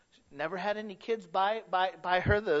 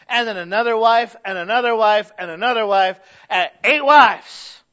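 preaching at full force; 4 semitones higher, 13 dB louder than usual. Acted. Preacher building to a shout about David's wives. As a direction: pile it on, louder each time.

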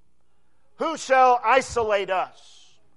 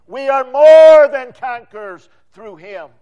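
loud triumphant declaration, pressing on more; shout of triumph; 12 dB louder than usual. Acted. Preacher rousing congregation with Paul's words: loud triumphant cry. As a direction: proclaim the victory full voice. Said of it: "is a shout of triumph".

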